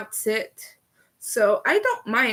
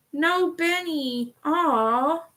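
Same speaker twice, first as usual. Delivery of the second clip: "No Benny" stretched slowly, higher and pouty; mock pout; 5 semitones higher, slower than usual. Shown as a demonstration. Streamer lamenting to chat that there is no Benny, mock pout. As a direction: whine "No Benny" like a sulking child. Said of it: "repeated in a pouty voice".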